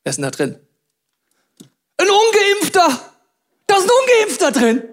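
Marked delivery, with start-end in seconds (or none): 2.0-4.8 high, loud mock horror; hammed-up outrage at the find; mock shock. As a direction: gasp it out like a shocked gossip. Mock shock at drawer contents.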